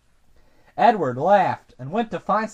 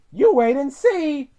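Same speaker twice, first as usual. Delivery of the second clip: higher, lightly voiced driver; confident retort; 7 semitones higher than usual. A narrator's reading. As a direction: answer the doubter in a higher voice. Driver answers Gordon's doubt.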